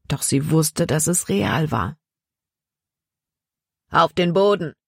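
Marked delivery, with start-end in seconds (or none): 3.9-4.7 lightly voiced gruff bandit giving an order; curt command, a touch rough. A narrator's reading. Bandit orders the travellers off the wagon, lightly rough commanding voice. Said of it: "befahl eine raue Stimme".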